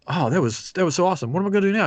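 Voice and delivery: put-on gushing like a thrilled kid at the fireworks; excited. Retelling a friend's thrill after the show, rising excitement.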